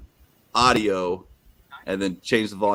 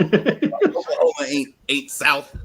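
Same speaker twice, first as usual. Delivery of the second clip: laughing; 3 semitones higher, slower than usual. Real, not acted. Jokes about the map.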